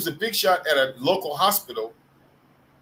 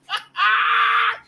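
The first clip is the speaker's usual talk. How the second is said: very high, loud cackle; high screeching laugh; 5 dB louder than usual. Real, not acted. Storyteller cracking up at the servant insult, shrill laughter. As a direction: burst out in a piercing squeal of laughter.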